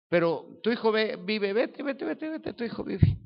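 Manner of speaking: voz fingida muy aguda; despedida apresurada imitada. Retold or imitated. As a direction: despacha a alguien repitiendo en tono agudo. (imitación aguda de quien despide con prisa)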